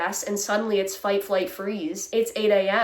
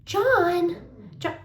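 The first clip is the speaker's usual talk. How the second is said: mock owner calling; 8 semitones higher than usual. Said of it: "they're like".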